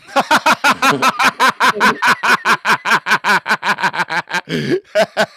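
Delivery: long, loud, high-pitched cackle; loud laughing fit. Real, not acted. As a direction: burst out laughing and keep it going. Finds own joke very funny.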